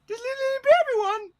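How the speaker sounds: high, silly cooing; playful baby talk. Pretend. Vlogger showing his little school dictionary, playful baby talk. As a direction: squeak it fondly at a tiny thing.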